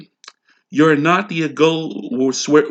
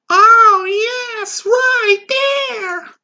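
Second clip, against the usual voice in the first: high, slow moan; passionate moan; 4 dB louder, much slower than usual. Acted. Wife moaning in bed, high slow moan.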